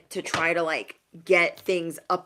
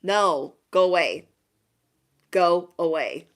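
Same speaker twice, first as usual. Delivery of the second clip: louder, firm brush-off; firm dismissal; 5 dB louder, slower than usual. Acted. You rejecting people who drop by, firm dismissal. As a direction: shoo them away forcefully. Sending intruders away.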